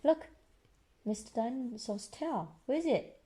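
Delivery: ordinary delivery, nothing marked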